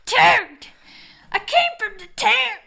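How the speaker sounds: high, loud urgent yelling; panicked shout. Acted. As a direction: burst in yelling the alarm. Henchman rushes in to warn boss.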